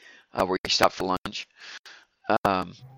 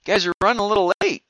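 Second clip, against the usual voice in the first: higher, quick greeting to arrivals; lightly voiced woman, pitched up and brisk; woman at the loading dock; 10 semitones higher, 4 dB louder, much faster than usual. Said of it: "a woman comes out".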